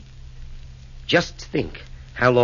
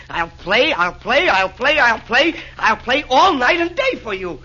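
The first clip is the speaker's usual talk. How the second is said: each I'll play climbing higher and louder; building delight; 12 semitones higher, 4 dB louder than usual. Acted. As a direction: build from glad to ecstatic. Nero's mounting delight.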